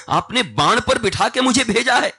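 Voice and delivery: high, loud impassioned sermon; preaching at full force. A narrator's reading. Hanuman telling Ram of his journey, in loud fervent preaching. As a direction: drive it home with fervour.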